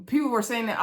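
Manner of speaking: ordinary talk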